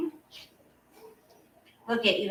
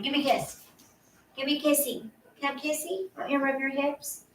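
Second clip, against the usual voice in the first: higher, soft pet-talk coo; 3 semitones higher, 4 dB quieter, faster than usual. Real, not acted. Owner coaxing her dog for a kiss, gentle baby talk. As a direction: sweet-talk him for a kissy.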